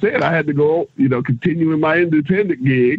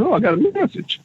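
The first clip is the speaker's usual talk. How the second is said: high, surprised little exclaim; 12 semitones higher than usual. Retold or imitated. To himself in the hotel room, surprised and high. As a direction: perk up at the blinking light. Retold surprise at phone light.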